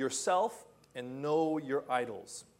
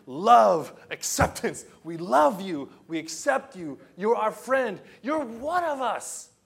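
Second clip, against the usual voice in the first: loud and insistent, each word hammered; pounding it in; 5 semitones higher, 9 dB louder than usual. Retold or imitated. Preacher pounding love into his doubting friend, loudly hammering each word. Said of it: "I wanted to pound it".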